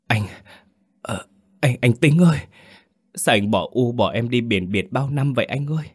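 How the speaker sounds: faltering words rising into a heartfelt appeal; emotional calling. A narrator's reading. Younger brother calling his dead brother.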